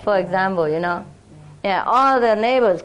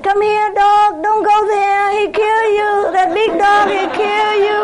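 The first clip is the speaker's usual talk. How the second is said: coaxing neighbor; 9 semitones higher, 3 dB louder than usual. Retold or imitated. Neighbor calling her dog away from the big Rottweiler, high and sweet.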